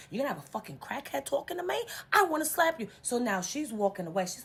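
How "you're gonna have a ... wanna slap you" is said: high-pitched indignant retort, building louder; retold blow-up at a friend; angry outburst. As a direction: build from disbelief to a loud threat. Retelling her quarrel with friend.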